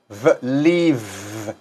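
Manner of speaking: careful teacher demo; lip V. Shown as a demonstration. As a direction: show the lip and teeth V, then "leave.". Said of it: "cómo se pronuncia con mi labio".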